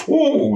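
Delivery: a high, sudden ooh as the stomach drops. Retold or imitated. Telling the host about the downhill, a high stomach-drop ooh. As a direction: squeal it like the car just went over a dip. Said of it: "I felt my stomach go".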